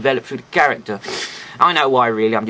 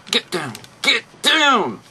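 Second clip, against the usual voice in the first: reviewer wrestling with a stiff toy, higher strained command; drawn-out, higher-pitched "get down" with mock effort; straining command; 6 semitones higher, slower than usual. Reviewer pushing on a toy part, straining command.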